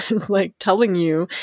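usual speaking voice

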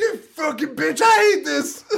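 high, shrieked insult, laughing at the end; mock screaming. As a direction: scream at the psychiatrist like a brat. Mocking the patient's outburst.

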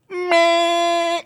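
long, high buzzer bleat; door buzzer. Retold or imitated. Elevator warns the door is held, buzzer imitation. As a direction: drone like an elevator alarm. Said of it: "like, it buzzes you".

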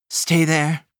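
plain reading voice

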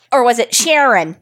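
a bad, hammed-up Boston accent on Sharon; terrible Boston accent. Podcast host jokingly mispronouncing a listener name, bad Boston accent. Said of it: "That was a terrible Boston accent".

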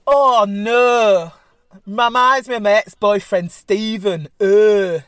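hammed-up Yorkshire moan about an ex; Yorkshire accent. Shown as a demonstration. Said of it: "Can I do a Yorkshire accent?".

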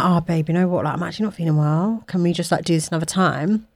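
soft, low mock apology; imitating a poorly partner cancelling; feeble excuse. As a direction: beg off quietly, voice dropped low. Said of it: "the old me would have just been like".

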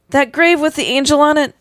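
high-pitched, urgent mild yell. A narrator's reading. Child reports the angel grave to grandmother, mild yell. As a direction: yell it upward without much volume. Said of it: "you yell".

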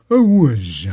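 a mock Bowie "whiz", hissed Z; Bowie noise. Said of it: "This is [name] opening a door".

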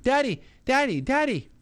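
put-on high, bright toddler calling Daddy; excited toddler. Little daughter running in calling her daddy, excited toddler voice. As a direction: call out like an excited little girl. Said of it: "she comes running in".

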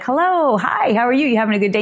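high bubbly hello from a cheerful passer-by; mock cheerful greeting; chirpy greeter. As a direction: play the overly sunny friend. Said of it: "where someone's like".

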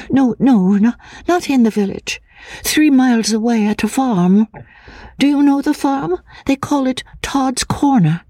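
put-on high, hesitant, frightened girl's voice; a nervous fragile woman, stammering; lightly breathy, fearful young woman; timid young woman. A narrator's reading. Anne's voice in dialogue.

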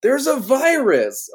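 high, slow, hammy calming voice; bad acting. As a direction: overact the earnest hero warning her. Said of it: "he's like".

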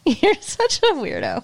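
giggling through the words; laughing. Real, not acted. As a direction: laugh it out at a silly friend. Laughing at partner's impression.

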